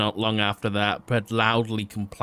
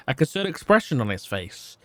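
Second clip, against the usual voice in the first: ordinary delivery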